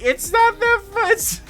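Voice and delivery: high, breaking into laughter; trying to talk through laughter; laughing. Real, not acted. Watching his brother laugh, cracking up himself. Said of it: "I'm laughing too".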